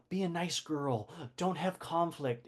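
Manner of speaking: soft, lightly voiced parent lecturing a girl; mock parental advice. Parent telling a daughter to behave, lightly voiced. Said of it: "they always say".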